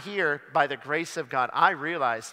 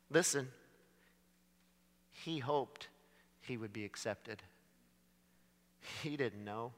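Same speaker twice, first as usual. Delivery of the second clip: ordinary talk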